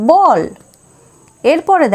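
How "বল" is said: বল said higher and louder, each sound clear; বল pronounced as ball, raised and loud; model word said clearly. Shown as a demonstration. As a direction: announce বল like a teacher's model word. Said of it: "আমরা পড়ব".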